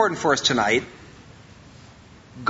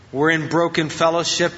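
ordinary delivery

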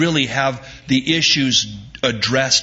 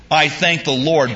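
ordinary delivery

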